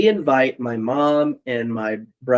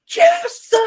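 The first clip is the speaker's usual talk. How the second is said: a much higher, louder mock yell of "Jason!"; calling out; 6 dB louder than usual. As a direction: call his name across the snowy yard, way up high. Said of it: "he's going".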